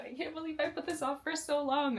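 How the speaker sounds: high, emotional disbelief; excited disbelief. Real, not acted. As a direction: marvel at yourself for waiting so long. Overwhelmed by the book.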